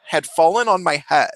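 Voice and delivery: ordinary delivery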